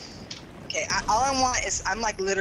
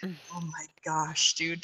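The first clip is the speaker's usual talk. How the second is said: ordinary talk, nothing marked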